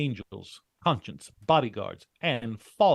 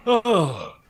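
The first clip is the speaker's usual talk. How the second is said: excited brag; 5 semitones higher, 6 dB louder, slower than usual. Acted. After the fight, panting brag.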